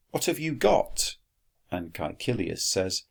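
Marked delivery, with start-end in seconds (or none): 0.1-1.1 put-on higher, questioning boy voice; lightly lifted pitch for Quintus; high character voice. Teacher voicing Quintus, lightly high.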